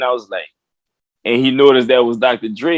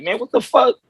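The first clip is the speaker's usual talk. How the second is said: imitating inward disbelief, pitched up high; mock indignation; 10 semitones higher than usual. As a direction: act out a baffled private protest. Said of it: "in his mind, he was like".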